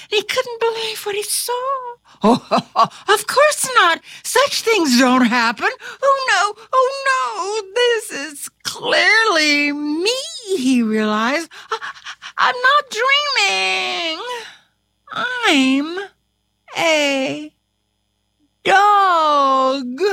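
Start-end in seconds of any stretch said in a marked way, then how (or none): 2.2-10.2 nervous laugh turning into high wail; rising panic. A narrator's reading. Carpenter discovering he is a dog, mounting panic. 11.7-20.0 high, wailing disbelief; distraught realization. A narrator's reading. Carpenter realizing he's a dog, distraught wail.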